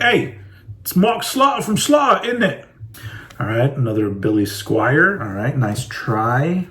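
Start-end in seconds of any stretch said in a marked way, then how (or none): 0.0-2.7 higher, chirpy British accent; perky British hello; other British voice. Shown as a demonstration. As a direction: greet cheerily in a British accent. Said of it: "otherwise it'd be like".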